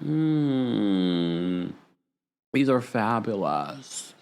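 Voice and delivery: soft, slow blasé purr; feigned nonchalance. Pretend. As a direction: act like it's nothing special. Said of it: "pretended like I've seen this before".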